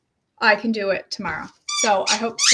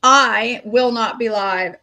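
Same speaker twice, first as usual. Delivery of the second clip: ordinary talk, nothing marked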